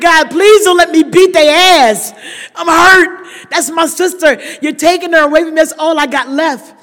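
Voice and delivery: loud, anguished plea; desperate loud prayer. Retold or imitated. Sister praying in the hospital, loud and anguished. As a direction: cry out to God in pain.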